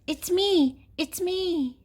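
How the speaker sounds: high, excited little sheep voice; sheep character. A narrator's reading. Sheep revealing who is under the mask, high-pitched. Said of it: "says the sheep".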